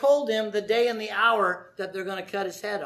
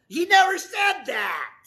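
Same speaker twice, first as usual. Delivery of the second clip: high, slow, loud shout; emotional outburst; 9 semitones higher, 5 dB louder than usual. Real, not acted. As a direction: burst out in real, forceful protest. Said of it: "I got a little emotional there".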